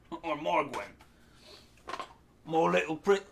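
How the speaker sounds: hammed-up Cockney goblin accent. Shown as a demonstration. Game master demos how goblins speak now, mock Cockney accent. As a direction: play a Brexit goblin with a broad Cockney accent. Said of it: "in a cool Cockney accent".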